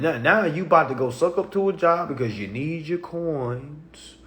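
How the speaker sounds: usual speaking voice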